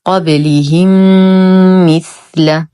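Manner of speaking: "قبلهم مثل" joined by a long hummed م; merged meems. Shown as a demonstration. Teacher models merging for learners, long nasal "قبلهم مثل". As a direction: hold the doubled meem between "قبلهم" and "مثل". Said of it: "إدغام مثلين مع غنة أكمل ما تكون".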